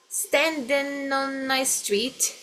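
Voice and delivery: slow, stretched "Standing on a street" with consonants joined to vowels; linked words. Shown as a demonstration. Teacher shows consonant joining vowel, slow linked words. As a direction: draw out "Standing on a street" as one joined chain. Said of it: "consoante, vogal, junta tudo isso aqui".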